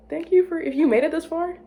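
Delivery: high, loud cheerful thanks; excited sign-off. Real, not acted. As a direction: beam at the viewers.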